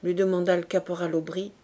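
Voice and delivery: ordinary delivery, nothing marked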